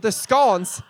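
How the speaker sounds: loud British "scones" with a short O, like "skonz"; British accent. Shown as a demonstration. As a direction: say "scones" British-style, rhyming with "dons". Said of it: "that was a really bad accent".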